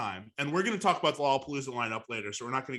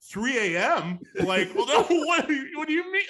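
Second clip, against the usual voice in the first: taken aback, rising into a higher stammer; mock incredulous; 7 semitones higher than usual. Performer reacting to a text about a late slot, mock incredulous. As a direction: act thrown by the news, laughing a little.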